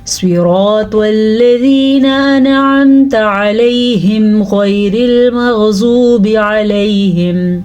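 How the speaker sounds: slow, drawn-out melodic tajweed recitation; Quran recitation. Shown as a demonstration. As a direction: chant each verse slowly with long held vowels. Full tajweed recitation of Fatiha. (سست، لمبی آواز میں تجوید کے ساتھ تلاوت)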